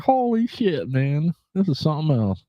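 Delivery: high-pitched mock astonishment; retold amazement. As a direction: act out being amazed, pitch jumping up. Retells amazed reaction to AI accent.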